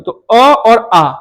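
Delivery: अ said short, आ held long, slowly; vowels stretched for comparison. Shown as a demonstration. Teacher voicing अ and आ slowly for students to compare length. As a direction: sound out अ and आ slowly so the length difference is heard. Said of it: "सबसे कम समय किस में लगेगा उच्चारण करने में".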